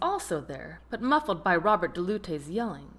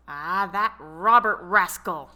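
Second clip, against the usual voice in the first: louder, slow scornful spit; spat out; 7 dB louder than usual. A narrator's reading. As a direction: spit the name in contempt. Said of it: "Detective Ross spit".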